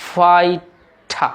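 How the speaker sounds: ফাইটা very slow, "fai... tha"; British pronunciation. Shown as a demonstration. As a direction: aspirate the t in ফাইটা, drop the r. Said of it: "British অ্যাকসেন্টে এই ওয়ার্ডটির উচ্চারণ".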